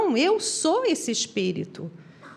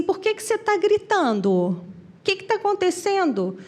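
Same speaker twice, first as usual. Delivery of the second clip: higher-pitched puzzled questioning; lightly voiced questioner; 6 semitones higher than usual. Spirit asks Chico why he screams, higher imitated voice. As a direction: ask it high, as if puzzled by the fuss.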